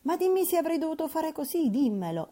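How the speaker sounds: insist, wringing her hands; shrill-pitched impatient young woman; irritated exclamation. Acted. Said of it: "ella esclamò in tono irritato".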